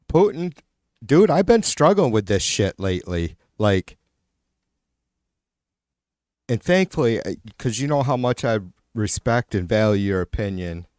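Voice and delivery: ordinary talk, nothing marked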